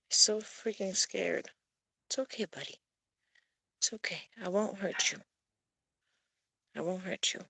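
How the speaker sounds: soft, hushed reassurance; calm a frightened kitty quietly; gentle murmur so the cat won't bolt; soothing a scared cat. Real, not acted.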